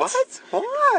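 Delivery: incredulous squeal, way up high; high squeak of disbelief. Real, not acted. Friend stunned by the plot, incredulous high squeak. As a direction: squeak it out like you can't believe it.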